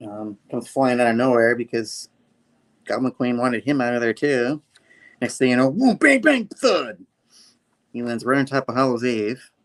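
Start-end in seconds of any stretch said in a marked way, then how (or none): 5.7-7.0 high, punchy comic-book bangs; impact effects. Acted. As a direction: act out each hit with the word. Imitating crash landing.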